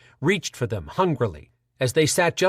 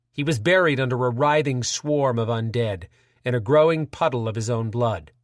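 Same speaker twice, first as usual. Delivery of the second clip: ordinary delivery, nothing marked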